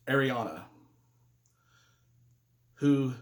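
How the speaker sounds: halting, with long heavy pauses; subdued, grieving delivery; real grief. Real, not acted. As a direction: struggle to get each phrase out about a loss. Recalls his girlfriend's death.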